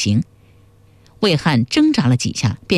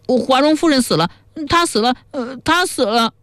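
high-pitched grieving lament; acted childlike wailing; 8 semitones higher than usual. A narrator's reading. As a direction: wail it like a child, as a narrator would act it. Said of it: "居然像个小孩子那样哇哇大哭起来".

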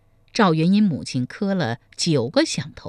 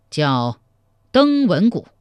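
deliberate, ringing naming of the drum; emperor's pronouncement; 4 dB louder, slower than usual. A narrator's reading. Emperor naming the palace drum after thought, proclaiming. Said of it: "赵匡胤默默想了良久".

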